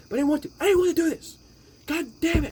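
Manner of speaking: high, loud exasperated yell; frustrated outburst. Real, not acted. YouTuber fed up with his own long video, shouting. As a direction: blow up at having to keep explaining.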